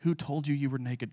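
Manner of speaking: soft, sorrowful ask; gentle question. Acted. God asking Adam, soft and sorrowful. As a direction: let the question fall quietly. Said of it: "God asks".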